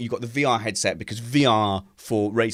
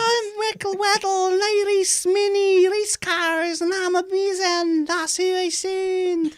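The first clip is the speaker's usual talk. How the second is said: very high, slow falsetto caricature; Michael Weddle impression; slower than usual. Mocking a race driver friend on air, squeaky impression. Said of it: "that exact same impression of him".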